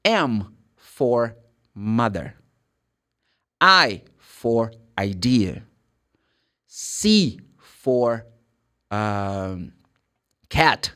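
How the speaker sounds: very slow, word-by-word spelling with long pauses; deliberate, spaced-out letter-by-letter dictation. Shown as a demonstration. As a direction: spell it out slowly for a learner, pausing after each word. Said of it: "Can you speak slowly, please?".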